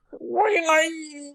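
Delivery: high, slow, whiny nasal singing. Retold or imitated. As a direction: whine it like a pop-punk singer. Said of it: "So nasally".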